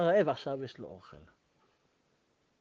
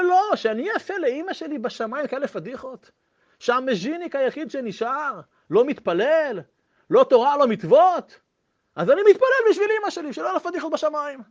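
higher, louder impassioned pleading; impassioned plea; 10 semitones higher, 7 dB louder than usual. Retold or imitated. Survivor explains why he prays for his mother, impassioned and loud. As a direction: argue with rising passion. Old man's emotional explanation.

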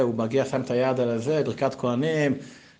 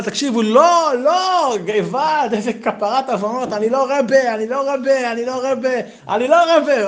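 much higher, louder, frantic denial; desperate protest; 12 semitones higher, 6 dB louder than usual. Acted. Yitzchak begging the crowd to stop, high and frantic. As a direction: plead frantically up high that he is no rebbe. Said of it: "הוא אומר לה".

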